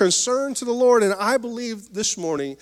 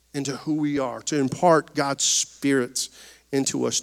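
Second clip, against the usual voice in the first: normal talking voice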